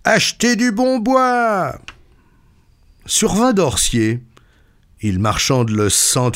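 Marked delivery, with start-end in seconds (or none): 0.0-1.8 high, slow street-seller cry; calling out. A narrator's reading. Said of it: "en criant".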